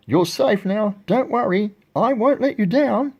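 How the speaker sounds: high-pitched reassuring call; calling back. A narrator's reading. Horton to the mayor of the town, high reassuring call. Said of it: "Horton called back to the mayor".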